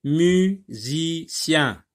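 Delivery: syllable by syllable. Shown as a demonstration. Teacher splitting words for pupils, slowly syllable by syllable. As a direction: break "Musicien" into three drawn-out syllables. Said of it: "Sépare les mots en syllabes".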